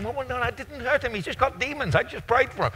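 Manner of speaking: high, thin-volumed protest; flustered excuse. Acted. Preacher imagines explaining himself to onlookers, high flustered excuse.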